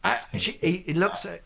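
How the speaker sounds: usual speaking voice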